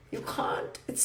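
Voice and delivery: breathy whisper under her breath; whispered aside. Real, not acted. Teacher whispering a firm aside to students. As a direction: drop to a whisper for emphasis.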